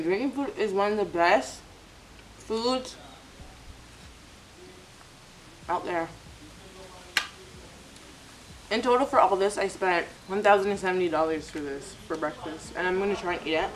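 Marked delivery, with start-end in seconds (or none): 0.0-11.1 chewing while talking; talking between bites; mouth full. Real, not acted. Seen 0: Eats fried pieces by hand and fork, chewing between words.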